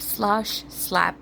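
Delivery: ordinary delivery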